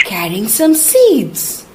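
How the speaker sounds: plain reading voice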